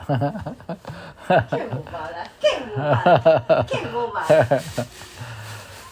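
big burst of laughter; hearty amused laugh; loud laugh. Real, not acted. Laughs at the poem.

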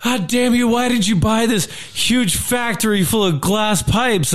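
high, scolding wife voice; a hint of a yelling wife; wife scolds husband about the pipe factory; mock yelling wife. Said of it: "wife's all yelling at him".

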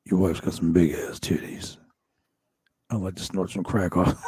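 low, unbothered leering impression; low-pitched wrestler voice, leering; Jake the Snake Roberts. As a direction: drop your pitch, say it shamelessly. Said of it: "And he's like".

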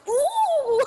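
high, loud imitated boy's ooh; hammed-up delighted ooh; thrilled "ooh". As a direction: squeal like a kid seeing his hero. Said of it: "the minute Fiyero came out".

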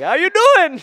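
very high, loud mock Canadian accent. Echoing coworker's phone greeting, shouted Canadian accent. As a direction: echo the hockey-bro greeting, shouted. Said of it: "He kinda just talks like that".

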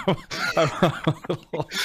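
talking through real laughter; laughing. Real, not acted. Host reacting to a caller blaming their content, laughing. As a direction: break up laughing mid-sentence.

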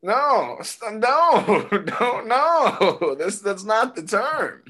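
high, drawn-out horrified no; retold alarmed protest. Retelling his reaction to a girl's wrong term, high alarmed protest. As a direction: pitch it up in amused, pleading correction.

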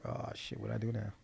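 hushed mock panic under the breath; man panicking at his wife's words, whispered; whispered dread. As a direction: whisper it like you're in trouble. Reacting to the dreaded phrase.

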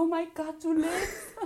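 high, laughing mock astonishment; hammed-up amazement breaking into laughter; amazed relief. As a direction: act out stunned joy at a survivor. Acting out finding father alive.

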